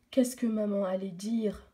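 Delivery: usual speaking voice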